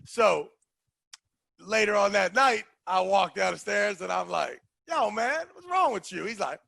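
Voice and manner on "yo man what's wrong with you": high-pitched exasperated challenge; retold confrontation. Confronting the loser later that night, exasperated. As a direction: act out confronting him, pitched up.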